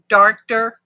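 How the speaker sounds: "Darker" said loudly as "darkter"; made-up word. Pretend. Crafter coining a word, "Darker" pronounced darkter with emphasis. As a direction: add a T to "Darker": DARK-ter. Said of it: "that's a real word".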